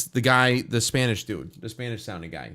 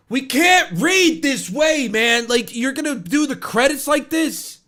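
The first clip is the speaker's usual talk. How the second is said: loud, high, disbelieving complaint; exasperated outburst; 12 semitones higher, 6 dB louder than usual. Real, not acted. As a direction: protest the scrolling credits out loud. Complaining about fast credits.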